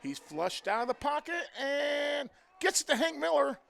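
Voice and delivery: loud, high, fast play call; excited play-by-play. Real, not acted. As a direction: shout the big run as it happens. Big play called live.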